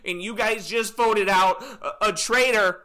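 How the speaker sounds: high, loud, slow wail; hammed-up weepy farewell speech; mock tearful reveal. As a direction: wail it at the whole room. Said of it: "I'd be standing in front of everybody".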